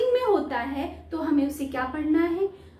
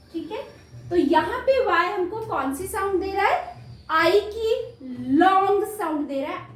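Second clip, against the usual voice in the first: ordinary delivery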